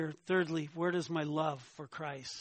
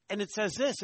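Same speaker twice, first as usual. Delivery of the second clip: ordinary talk, nothing marked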